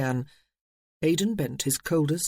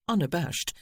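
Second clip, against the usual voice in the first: usual speaking voice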